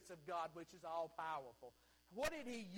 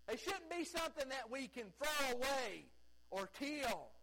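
louder, higher and building; preaching at full force; 7 semitones higher, 6 dB louder than usual. Real, not acted. Pastor pleading with the congregation that children are precious, fervent preaching. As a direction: drive it home like a revival preacher.

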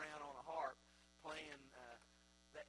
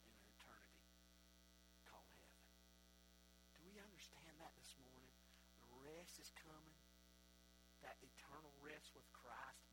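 lower, softer, intimate sermon voice; quiet reflective preaching; 4 semitones lower, 11 dB quieter than usual. Acted. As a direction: draw the congregation close, gently.

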